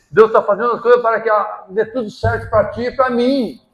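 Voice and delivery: loud, high, driving sermon; impassioned preaching. Acted. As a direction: hammer it home to the church. Preaching at full force.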